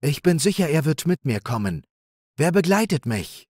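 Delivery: high, clear, determined doe. A narrator's reading. Ulla offers to fetch Nemo in a clear high voice. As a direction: speak up clearly and bravely.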